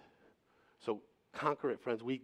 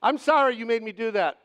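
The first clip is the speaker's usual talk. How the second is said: high, loud blame-shifting apology; a sarcastic non-apology, pushed up high and loud; mock apology; 9 semitones higher, 11 dB louder than usual. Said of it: "That kind of apology?".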